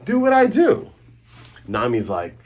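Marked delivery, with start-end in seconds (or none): 0.0-0.9 high-pitched whiny protest. Retold or imitated. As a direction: squeak it out like an offended hypnotist. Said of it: "And the James like".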